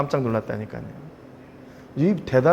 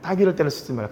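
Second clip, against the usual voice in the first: ordinary talk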